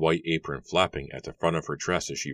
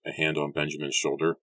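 usual speaking voice

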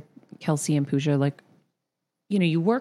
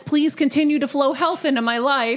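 mock prayer; 10 semitones higher than usual. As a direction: pray in a hammed-up rush. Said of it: "if I'm sitting there".

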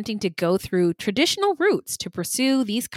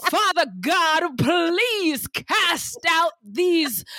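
slow, high, dragged-out church prayer; preacher prayer; 7 semitones higher, much slower than usual. Pretend. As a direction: pray it out like a revival preacher. Mock prayer.

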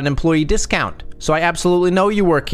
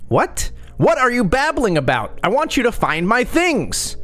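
high shout, pitched way up; 9 semitones higher than usual. A narrator's reading. Angry customer shouts at shopper about her things. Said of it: "she shouted".

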